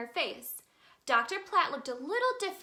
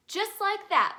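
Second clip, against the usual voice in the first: much higher, louder approval; bright encouraging mom; 8 semitones higher, 5 dB louder than usual. A narrator's reading. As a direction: cheer him on brightly. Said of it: "Mom Tiger replied".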